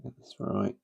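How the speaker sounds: breath held, barely voiced; holding breath. Real, not acted. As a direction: squeeze the words out while holding breath. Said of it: "doing the old holding my breath".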